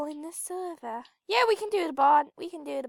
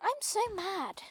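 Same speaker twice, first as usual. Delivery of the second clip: mock-mad, softer and falling; sarcastic; 3 semitones higher, 7 dB quieter than usual. Player telling viewers she's mad, sarcastic. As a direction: say it sarcastically, only pretending anger. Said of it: "I'm literally being sarcastic".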